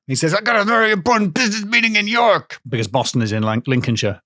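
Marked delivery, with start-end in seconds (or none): 0.3-2.6 high-pitched, hammed-up frantic businessman; over-the-top strained film impression; Mister Toomey. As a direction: parody a man desperate for his meeting. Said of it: "so he's like that".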